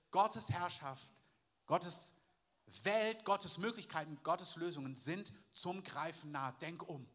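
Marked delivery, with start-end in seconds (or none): none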